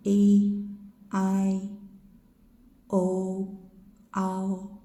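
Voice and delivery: each diphthong spoken clearly apart. Shown as a demonstration. Teacher demonstrating the diphthong row. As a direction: glide through ai and au.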